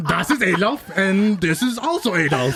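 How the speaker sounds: put-on hammy German-accented introduction; mock German accent. Parent introducing both kids, mock German accent. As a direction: present each one in a comic German accent.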